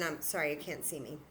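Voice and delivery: normal talking voice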